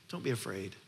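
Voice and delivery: soft, low and tender; gentle reassurance. Acted. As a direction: say it softly, like comforting a frightened father. Gentle echo of Jesus' words.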